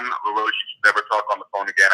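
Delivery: ordinary delivery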